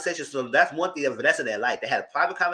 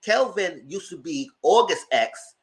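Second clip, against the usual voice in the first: ordinary delivery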